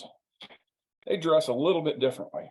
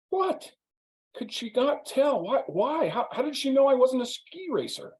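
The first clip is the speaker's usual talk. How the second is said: much higher, outraged disbelief; squeaky mock indignation; indignant; 11 semitones higher than usual. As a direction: play the offended guest who can't believe it. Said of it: "he's very indignant".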